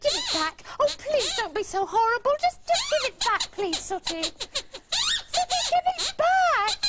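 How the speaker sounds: high, wailing plea that builds; desperate pleading. Acted. Girl begging teasing boys for her thing back, desperate pleading.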